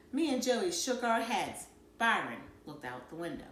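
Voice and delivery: usual speaking voice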